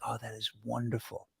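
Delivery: very soft, low murmur; hushed, low appreciation; delighted quoted response. Retold or imitated. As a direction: quote it under your breath, savoring. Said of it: "[name]'s response with the Baby Shark".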